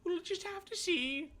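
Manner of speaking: mock whiny. Mocking repeat of friend.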